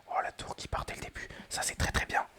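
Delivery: excited whisper pushed loud; a whisper that keeps rising with excitement; thrilled gamer whispering too hard. Real, not acted. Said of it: "je chuchote peut-être un petit peu fort".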